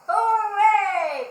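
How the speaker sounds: very loud, high cheer of joy; delighted squeal. Acted. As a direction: squeal it with pure pig delight. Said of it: "Pig squealed in the delight".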